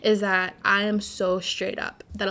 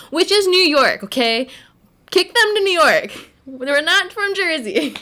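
loud, raised-pitch protest; indignant outburst; 7 semitones higher, 8 dB louder than usual. Real, not acted. Disowning the Jersey Shore cast as New Yorkers, loud indignant outburst. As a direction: hammer it out, indignant and high.

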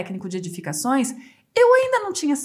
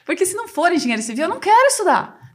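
louder, rising into a mock protest; indignant; 6 semitones higher, 4 dB louder, faster than usual. Student arguing with the enrollment clerk, indignant raised voice. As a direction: insist you only want engineering.

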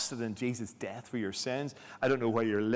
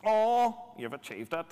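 Paul; 9 semitones higher than usual. Acted. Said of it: "this is Paul's sarcasm".

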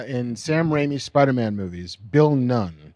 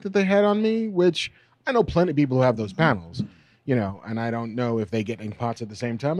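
ordinary talk, nothing marked

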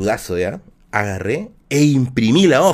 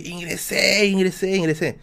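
higher mock yell, repeated without real volume; retold joyful shout; 6 semitones higher than usual. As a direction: act out shouting the news, held back. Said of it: "Yo le grité". (grito imitado de alegría, agudo y contenido)

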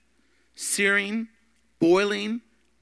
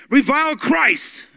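slow, loud, higher commanding ruler; Roman ruler demanding; 9 semitones higher, 9 dB louder, slower than usual. Retold or imitated. Ruler urging old bishop to deny Christ, commanding. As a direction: order him to renounce, drawn out.

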